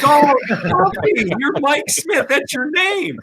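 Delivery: high, slow, drawn-out realisation; mock realisation. Coach realises the kid he called Stumpy is Mike Smith, high and slow. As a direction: stretch Stumpy out as the penny drops.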